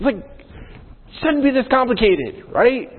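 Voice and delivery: high, slow, fed-up whine; exasperated. Real, not acted. Pastor grumbling to the congregation about tax forms, exasperated. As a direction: let the frustration squeak out.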